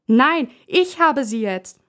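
put-on boy shouting at his brother to keep the doll; shouting refusal. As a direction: yell it at your brother, slowly. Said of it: "schreit der Junge ihn an".

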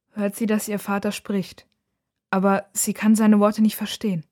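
usual speaking voice

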